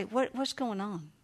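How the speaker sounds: soft, slow caring question; gentle check-in. Shown as a demonstration. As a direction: lean in and ask quietly. Demo asking child gently.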